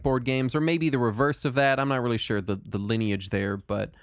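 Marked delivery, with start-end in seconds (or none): none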